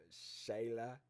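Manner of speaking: ordinary talk, nothing marked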